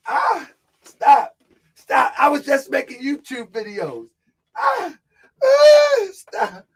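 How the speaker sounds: high, hammed-up whimpering; fake-cry for them to stop; mock crying plea. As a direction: pretend wailing, begging them to stop. Mock pleading with imagined attackers.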